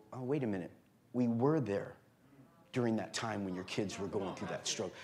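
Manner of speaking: very low, soft reenacted reply; retold hushed apology. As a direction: act out a meek apology under your breath. Retold apology to departing church member.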